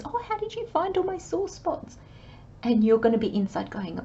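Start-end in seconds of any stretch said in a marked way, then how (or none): 0.0-2.0 much higher, delighted quote; amazed client voice. Retold or imitated. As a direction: play the amazed client, pitched up. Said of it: "When this person goes".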